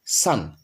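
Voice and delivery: সান with an open "a", low and falling; correct pronunciation. Shown as a demonstration. Teacher modelling the correct form, সান with open "a", falling. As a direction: open the vowel in সান, like English "sun". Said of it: "এটা সঠিক উচ্চারণ হচ্ছে".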